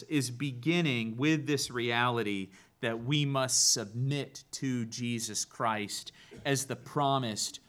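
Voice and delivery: ordinary talk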